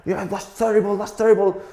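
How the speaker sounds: high-pitched mock rant, restrained in volume; imitated angry shouting. Old acting teacher berating his student, mock high shouting. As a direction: mimic a teacher losing it, voice pushed high. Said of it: "like, shouting".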